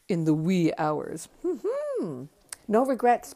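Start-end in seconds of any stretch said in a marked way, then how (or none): 1.4-3.3 much higher sing-song hum then louder declaration; cheeky smugness. Real, not acted. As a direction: gloat playfully to the audience. Cheeky boast to viewers.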